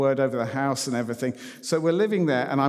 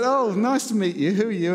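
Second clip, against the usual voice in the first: lightly voiced woman; 7 semitones higher, 3 dB louder than usual.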